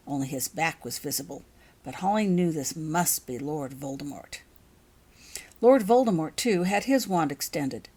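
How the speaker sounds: ordinary delivery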